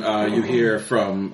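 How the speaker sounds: normal talking voice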